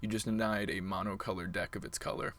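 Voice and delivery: usual speaking voice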